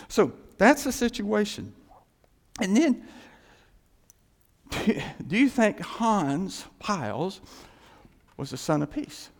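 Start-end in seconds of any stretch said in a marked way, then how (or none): none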